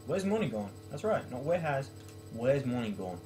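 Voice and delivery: ordinary talk, nothing marked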